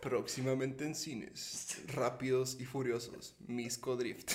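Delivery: slow, soft trailer announcer; deep, slow coming-soon voice; movie trailer voice. Pretend. Parodies a film announcement. (voz de tráiler de película)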